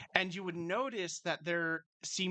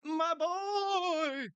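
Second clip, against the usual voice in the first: mock crying; 13 semitones higher, 4 dB louder than usual. Proud dad over new arrival.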